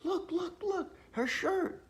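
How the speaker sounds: a friend shrieking to point out a shirt; retold excited screaming. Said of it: "Angela starts screaming".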